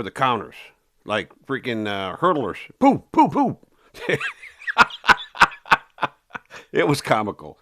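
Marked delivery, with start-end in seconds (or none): 2.8-3.6 mock leaping sounds. Cops leaping counters like hurdlers. 3.9-6.1 can't hold back a laugh at the memory; real high laugh. Real, not acted. Man laughing at his own prison story, real high laugh. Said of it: "I'm laughing at this".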